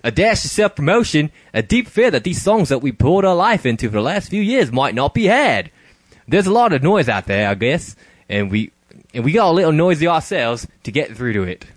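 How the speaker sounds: laughing through a light mock Australian accent. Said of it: "give him an Australian accent".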